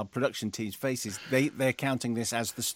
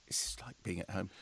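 under-the-breath whisper; whispered aside; 3 semitones lower, 11 dB quieter than usual. Real, not acted. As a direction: mutter it to yourself, unvoiced. Muttered aside.

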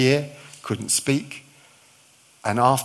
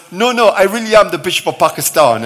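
insistent Pakistani bishop; 7 semitones higher, 11 dB louder than usual. Retold or imitated. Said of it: "that's the best voice I've ever heard you put on".

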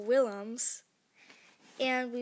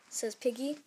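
plain reading voice

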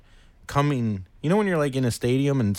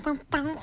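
high, soft vocal bum-bum riff; imitated jam-band riff; 7 dB quieter than usual. Concertgoer mimicking a jam band for co-host, high bum-bum riff. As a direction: sing out a little mock jam groove. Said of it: "everything's just like".

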